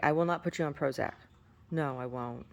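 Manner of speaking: usual speaking voice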